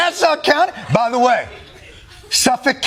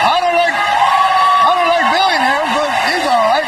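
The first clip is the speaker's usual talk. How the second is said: mocking supporter voice; 3 semitones higher than usual. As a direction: shout it like a gleeful fan.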